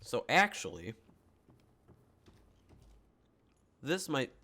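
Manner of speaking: chewing ice while talking; ice in mouth. Real, not acted. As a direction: talk around crunching ice. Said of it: "sorry, I'm chewing on ice".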